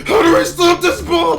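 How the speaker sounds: high, loud panicked shout. Pretend. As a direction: yell it in total panic. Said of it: "Imagine the Bruce trying to stop the bomb".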